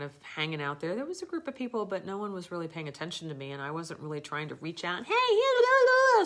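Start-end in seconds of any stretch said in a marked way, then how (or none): 5.0-6.2 calling out. Retold or imitated. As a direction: call across the pool to the dolphins. Calling out to dolphins.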